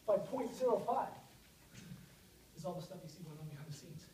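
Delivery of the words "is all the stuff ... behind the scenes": quick and softer, running out of air; out of breath. Real, not acted. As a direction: rush it out softly, short of breath. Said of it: "Now I'm out of breath".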